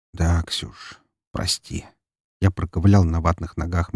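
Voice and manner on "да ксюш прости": faint, breathless apology; exhausted whisper. Acted. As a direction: barely get the words out.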